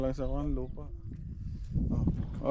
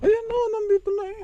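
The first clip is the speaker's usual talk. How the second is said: excited squeal; 6 dB louder, faster than usual. Real, not acted. Alpaca arriving, squeal.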